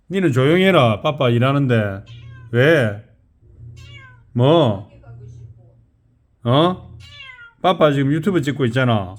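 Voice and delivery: loud, firm telling-off; scolding. Real, not acted. Speaker hushing the household while filming YouTube, scolding. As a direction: hush them loudly and firmly.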